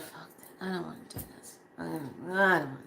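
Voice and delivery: soft, breathless giving up; defeated refusal. Retold or imitated. Quitting her path again, a soft, whiny refusal. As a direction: mumble it like you've lost the fight. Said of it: "I said".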